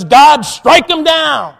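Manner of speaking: loud, drawn-out call for punishment; mock a self-righteous believer thundering at full voice; arrogant, judgmental cry. Preacher voicing a prideful believer calling down judgment, loud and arrogant. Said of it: "a prideful, arrogant attitude that says".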